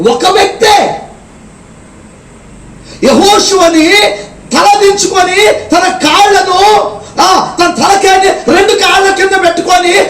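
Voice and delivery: high shouting preacher, slow in places; fiery preaching. Acted. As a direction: cry it out to the congregation. Preacher at full force. (గట్టిగా అరుస్తూ బోధించడం)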